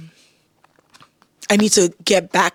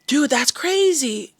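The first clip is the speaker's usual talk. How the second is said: mock gasp; 7 semitones higher, much slower than usual. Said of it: "I'm like".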